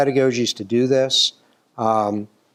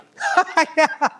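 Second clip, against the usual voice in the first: burst of laughter; 3 dB louder than usual. Real, not acted. Laughing at mom's reaction.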